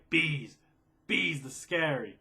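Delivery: loud, drawn-out cry of dread; loud fearful outburst. Real, not acted. Yelling about his bee phobia, loud and fearful. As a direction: blurt it out like the bees are near.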